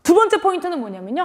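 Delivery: ordinary talk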